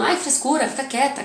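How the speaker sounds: a hint of an annoyed grown-up; mock scolding adult. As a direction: brush off the child's tears impatiently. Adult scolding a crying child.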